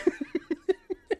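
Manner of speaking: long high-pitched giggle; real helpless laugh. Real, not acted. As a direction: break into squeaky laughter. Laughing at the game.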